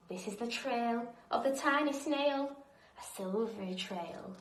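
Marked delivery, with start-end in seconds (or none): none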